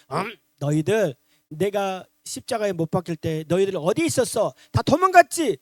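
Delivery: stern questioning rising to a loud shout; mock scolding. Imagined Jesus scolding disciples who fled, mock scolding. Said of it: "호통을 칠 수 있잖아요".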